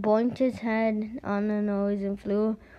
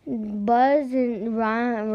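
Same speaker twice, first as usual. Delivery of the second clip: usual speaking voice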